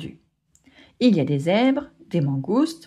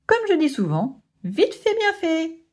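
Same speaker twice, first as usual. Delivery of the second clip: smug boast; 8 semitones higher than usual. Acted. Bunga boasting his motto.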